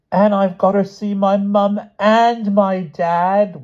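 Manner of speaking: put-on loud, very high, drawn-out child's wail. A narrator's reading. Jammy crying out for mum and dad, wailing. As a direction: wail slowly on one high, insistent note. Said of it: "wailed Jammy".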